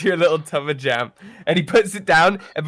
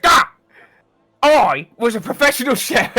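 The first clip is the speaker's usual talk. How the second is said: louder and higher, huffy outrage; indignant chef; 8 semitones higher, 9 dB louder than usual. Acted. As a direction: play the offended cook throwing up his hands. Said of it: "And then he just goes".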